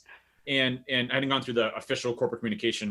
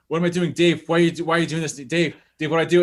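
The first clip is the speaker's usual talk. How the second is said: louder, higher mock fretting; serious, anxious imitation; 4 semitones higher, 6 dB louder than usual. Co-host imitates Chris pleading with Dave before broadcast, anxious. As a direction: imitate a friend urgently pressing for answers. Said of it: "he's really serious".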